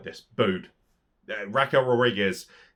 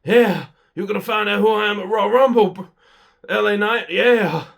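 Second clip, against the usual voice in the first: higher, louder mocking wrestler promo voice; mock Bray Wyatt promo; 8 semitones higher, 7 dB louder than usual. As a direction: ham up a lazy wrestler promo. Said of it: "he literally gets on the mic and goes".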